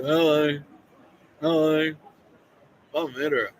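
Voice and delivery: lightly mocking caricature; mocking imitation. Mimicking people who don't stay home.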